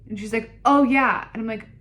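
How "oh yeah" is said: loud, high retold reply; emphatic mock agreement. Nurse answering patient's question about lead, loud and emphatic. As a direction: imitate a breezy, emphatic yes.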